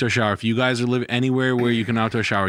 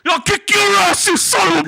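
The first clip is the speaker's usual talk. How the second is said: put-on furious drunken yell, much higher and louder; belligerent drunk; 8 dB louder than usual. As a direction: scream it like an angry drunk. Acted drunk quarrel about drinking.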